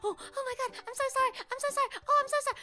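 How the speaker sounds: high-pitched, flustered apologies; panicking nurse. Retold or imitated. Flustered nurse apologising while botching the needle, high panicked voice. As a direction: babble apologies in a mock panic. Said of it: "She was full on panicking".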